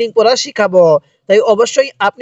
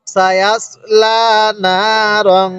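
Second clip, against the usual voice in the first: slow সায়াসলা না রং with held long vowels; measured teaching recitation; model Quran recitation; much slower than usual. Shown as a demonstration. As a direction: stretch লা and না, hum out the end of রং. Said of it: "তাহলে কিভাবে পড়ব?".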